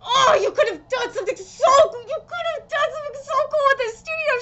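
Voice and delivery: high, loud, fast anguished shout; anguished wail. Real, not acted. Fan lamenting the wasted scene with a high anguished shout. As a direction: wail at what could have been.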